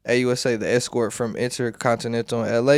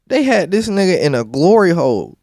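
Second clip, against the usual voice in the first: high, loud disbelieving exclaim; shocked outburst; 7 semitones higher, 8 dB louder than usual. Real, not acted. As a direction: blurt it out in disbelief. Shocked reaction to testimony.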